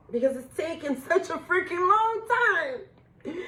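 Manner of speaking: laugh-cry with relief; laughing through tears. Real, not acted. Laughing-crying over long wait.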